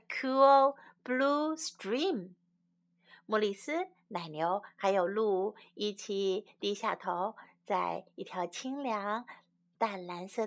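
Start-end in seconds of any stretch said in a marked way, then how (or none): none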